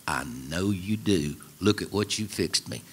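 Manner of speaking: a husband fondly thanking his wife for supper; contented tender reply. Retold or imitated. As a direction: savor it, a quiet pleased murmur to his wife. Husband answering his wife lovingly.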